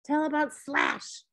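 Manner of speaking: put-on high, childlike plea; begging little boy. A narrator's reading. Three-year-old asks his friend for a pirate tale, begging. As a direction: plead for a favorite tale in a toddler's voice. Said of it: "Theo begged".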